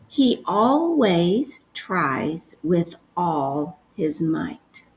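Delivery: very slow repeated dictation. Shown as a demonstration. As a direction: stretch the sentence out slowly. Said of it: "the next sentence you're gonna write is".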